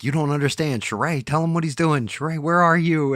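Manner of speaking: high, loud panicked calling; a frightened friend shouting for Sheree; panicked pleading. Retold or imitated. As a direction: imitate her frantic calls for help. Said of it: "she's like".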